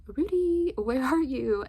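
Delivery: high, loud searching call. Retold or imitated. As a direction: act out calling for someone lost. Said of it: "calling out for Rudy".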